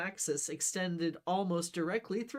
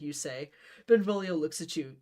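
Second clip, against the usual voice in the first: ordinary delivery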